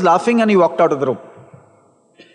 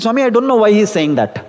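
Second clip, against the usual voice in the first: ordinary delivery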